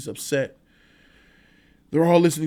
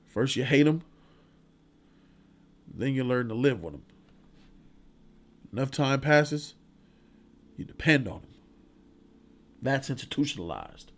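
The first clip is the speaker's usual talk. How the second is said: Red's speech; 3 semitones lower, 3 dB quieter than usual. Retold or imitated. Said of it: "he was like".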